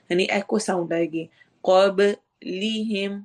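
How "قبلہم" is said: قبلہم with a bouncing echo on the ب; echoing qalqala on b. Shown as a demonstration. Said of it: "با کے اوپر قلقلہ ہوگا". (قلقلہ کے ساتھ قبلہم)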